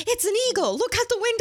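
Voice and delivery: high-pitched mock excitement; excited exclamation. Co-host retelling Danny spotting an eagle mid-recording, excited exclamation. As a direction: blurt it high, pointing out a sudden sighting.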